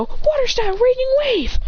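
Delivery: high-pitched anime attack call; technique call. Acted. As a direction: call the move out high, as if unleashing it. Fighter calls out his technique.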